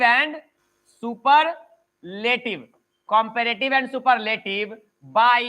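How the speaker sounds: drawn-out words with long pauses; very slow, syllable-spaced dictation. Real, not acted. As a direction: dictate word by word, stretching each one. Teacher dictates a rule slowly.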